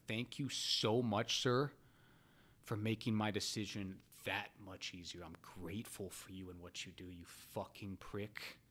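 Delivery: mock gratitude dropping to a low, soft curse; seething sarcasm. Sarcastic thanks to shop clerk.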